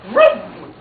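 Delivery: loud, high car vroom; high revving engine noise; vroom sound effect. A narrator's reading. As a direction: imitate a car zooming off loudly. Car sound in story read aloud.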